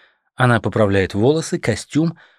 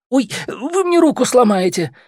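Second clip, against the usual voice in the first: high pained cry, much higher; pained yelp; 13 semitones higher, 3 dB louder than usual. A narrator's reading. Man with twisted arm yelps at her in pain. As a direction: squeal as the arm bends.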